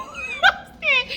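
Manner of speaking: a high, real laugh breaking out; high burst of laughter. Real, not acted. Laughing at her own story.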